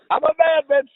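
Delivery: normal talking voice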